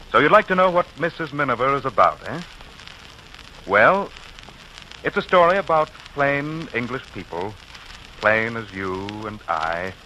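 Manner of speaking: ordinary talk, nothing marked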